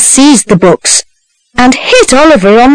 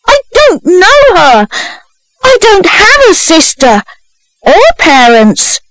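put-on high, desperate boyish protest; terrified; 9 semitones higher than usual. A narrator's reading. Boy disowning a false sister, high terrified denial. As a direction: deny it in high-pitched fright. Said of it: "replied Oliver, terrified".

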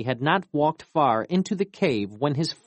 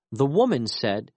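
plain reading voice

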